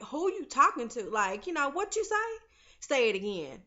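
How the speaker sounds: mock menace, pitch jumping up on the question; retold confrontation. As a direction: challenge him, daring him to repeat it. Ghost confronts his son.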